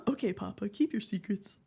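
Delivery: soft, whispery reply; hushed aside. Real, not acted. Streamer lets Papa keep secrets, hushed. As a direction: drop to a near-whisper.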